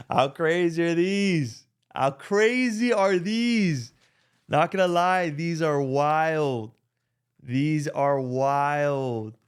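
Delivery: loud awe at a prized reveal, easing down gradually; amazed hype. Real, not acted. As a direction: marvel loudly, stretching the words out. Showing off the shoes in amazement.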